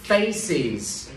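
"Faces" in two drawn-out syllables, falling; clear final syllable. Shown as a demonstration. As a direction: sound the added -iz at the end of "Faces". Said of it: "đó chính là âm gió các bạn kết hợp". (đọc "Faces" rõ hai âm tiết)

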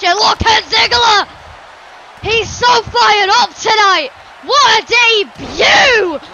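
loud, high-energy wrestling commentary; breathless-paced ringside hype, punching key words; excited play-by-play. Acted. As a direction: call the big moves with shouted peaks. Live wrestling match commentary.

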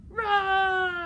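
high, very loud, long roar; dinosaur roar. Shown as a demonstration. Librarian showing children how dinosaurs sound, roaring. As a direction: demonstrate a big dinosaur roar. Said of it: "here's how they sound".

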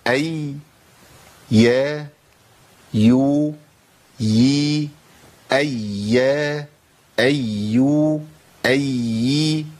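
each syllable أي يا يو يي voiced clearly and drawn out; demonstrating ya sounds. Shown as a demonstration. Teacher modelling ya sounds for students to repeat. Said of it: "طيب نقولها بقى".

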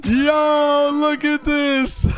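high, drawn-out amazed call; exhilarated. Real, not acted. Gamer riding the lift up first time, exhilarated. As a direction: stretch every word, high and thrilled.

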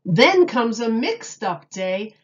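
lively picture-book read-aloud with big openings; reading to children. Acted. Miss Julie reading Dr. Seuss to children, animated storytime voice. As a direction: read it like storytime for little kids.

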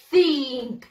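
"Think" said loud and clear for pupils to repeat; model pronunciation. Shown as a demonstration. Teacher modelling "Think" for pupils to repeat, loud. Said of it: "Repeat after me".

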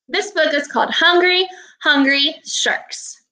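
lively read-aloud voice, unhurried; reading aloud to children. Acted. Teacher reading a shark book to her class, storybook read-aloud.

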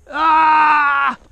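sharp cry of pain when hit; pained shout. Real, not acted. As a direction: yell out as the shot lands. Just got hit, cries out.

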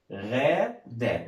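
غادة drawn out very slowly as غا, then دة; syllable by syllable. Shown as a demonstration. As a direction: sound out غادة with a long first syllable. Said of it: "هننطقها نستهجها واحدة واحدة".

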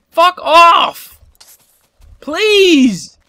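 loud, high frustrated shout; desperate loud plea; exasperated yell. Real, not acted. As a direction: yell at it to leave. Yelling at creeper in game.

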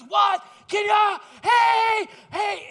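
high, very loud calling out; shouting. Retold or imitated. Golfer racing toward Sam, loud high shouts. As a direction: yell across the fairway.

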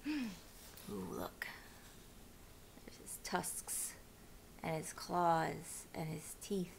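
hushed whisper over the picture. Shown as a demonstration. Reader pointing out the Gruffalo picture, whispering. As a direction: breathe it like a secret to the children. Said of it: "showing the pictures".

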